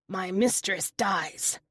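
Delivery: slow, rough broken speech of a lizard. A narrator's reading. Lizard to the panther, slow creature voice. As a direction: drag each word out like a grieving creature.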